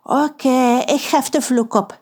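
grudging, unwilling mumble of a promise; reluctant. Acted. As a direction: say it because you have to. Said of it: "met tegenzin". (met tegenzin, onwillig)

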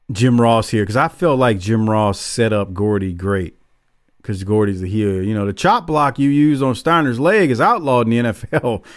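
usual speaking voice

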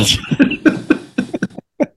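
breathy laugh. Real, not acted. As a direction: laugh at your own jab. Laughs at own joke on Bill.

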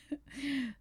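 a short real laugh, falling away; rueful laugh. Real, not acted. Telling her life story, she laughs off dropping out. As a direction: laugh at yourself for never going back. Laughs at own failed plan.

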